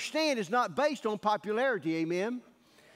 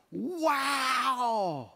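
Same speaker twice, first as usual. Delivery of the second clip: amazed wow; 4 semitones higher than usual. Retold or imitated. Deacons amazed in retold scene.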